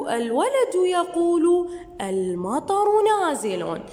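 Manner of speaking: slow, careful reading with full endings; slow reading aloud. Shown as a demonstration. Teacher rereading the boy's words to pupils, slow. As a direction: read the line slowly for the class.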